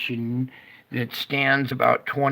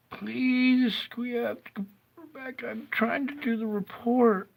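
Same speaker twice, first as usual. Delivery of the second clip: whiny pleading; 8 semitones higher, 3 dB quieter than usual. Real, not acted. Streamer begs the Lord to bring the computer back, high whiny plea. Begging God over a frozen computer.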